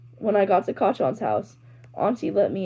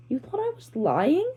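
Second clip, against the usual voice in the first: high, indignant question; offended; 8 semitones higher than usual. A narrator's reading. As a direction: sound hurt that he doubted you.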